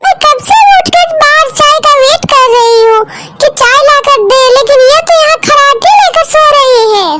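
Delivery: high-pitched scolding wife voice; nagging wife. Acted. Wife scolds sleeping husband. (ऊँची आवाज़ में डाँटती पत्नी)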